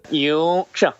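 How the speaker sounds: Yorkshire squashed to Yuk-sha, local style; Yorkshire with the r dropped, a mock local take; local Yorkshire pronunciation. Shown as a demonstration. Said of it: "how do we pronounce it".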